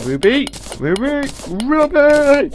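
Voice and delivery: from a call to a high, loud yell; cheering a treasure find; building excited calls. Real, not acted. Calling out the ruby, building.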